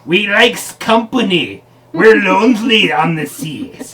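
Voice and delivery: slow, high mock pirate drawl. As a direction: play the lonely pirate. Pirate character answering.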